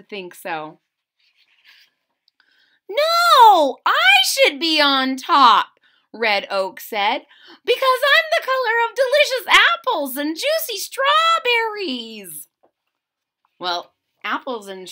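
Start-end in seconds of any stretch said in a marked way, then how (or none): 2.9-5.8 high loud protest; insistent leaf. Acted. Leaves squabbling over the top. 7.6-12.5 much higher proud leaf voice; boastful leaf. Acted. Red Oak voiced in story.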